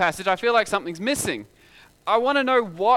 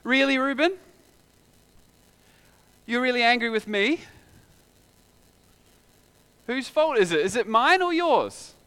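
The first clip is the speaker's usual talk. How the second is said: a hint of an exasperated God, raised; God confronting Cain, stern and raised; stern rebuke; 6 semitones higher, 4 dB louder than usual. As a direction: call him out, disbelieving then firm. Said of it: "God says".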